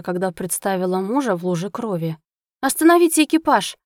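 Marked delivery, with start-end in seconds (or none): 2.6-3.8 raised, louder shout to the coachman; high, forceful call to halt. A narrator's reading. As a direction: cry out an urgent order. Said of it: "выкрикнула возничему".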